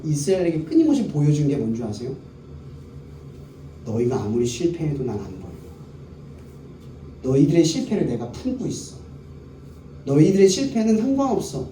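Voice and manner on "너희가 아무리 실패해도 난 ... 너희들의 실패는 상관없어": God's tender reassurance. Retold or imitated. Voicing God's comforting words.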